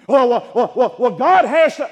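very loud, high mock objection; blustering protest. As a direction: burst in with stammering, shouted objection. Mock protest to God, cut short.

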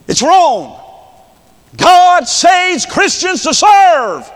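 high, loud impassioned sermon shout; full-force preaching. Real, not acted. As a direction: thunder it at the congregation as a warning.

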